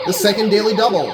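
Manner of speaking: quick, loud game-show announcement; brisk, punchy host call-out; announcer call. Acted. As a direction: sell the daily double like a host. Game show daily double announcement.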